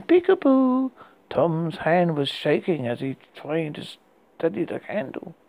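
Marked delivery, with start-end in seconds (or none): 0.0-0.9 put-on high, eerie little girl singsong; a creepy child calling out. A narrator's reading. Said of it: "a little girl voice saying".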